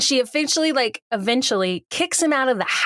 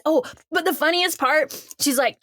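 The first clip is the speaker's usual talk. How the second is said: ordinary talk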